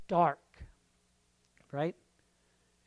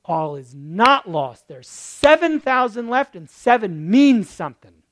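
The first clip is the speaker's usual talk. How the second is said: loud, slow Certainly not; emphatic preaching; Paul's loud proclamation; 15 dB louder, slower than usual. Acted. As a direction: thunder the answer. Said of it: "Paul says".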